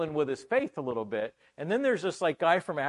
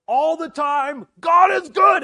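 much higher and louder, slow call; congregation shout; 13 semitones higher, 14 dB louder, slower than usual. Retold or imitated. Preacher imitating the African congregation's response, shouted. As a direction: shout it back like a whole congregation. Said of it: "And they go".

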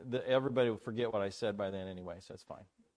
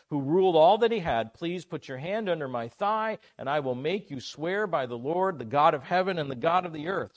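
loud, raised-pitch Bible reading with urgent asides; scripture reading; 6 semitones higher, 8 dB louder than usual. Real, not acted. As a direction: proclaim the oath forcefully to the congregation.

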